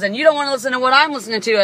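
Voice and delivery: fast, high, loud imitation; rapid mocking quote. Imitating the driver's retort, fast and loud. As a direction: rattle it off like her. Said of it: "she goes".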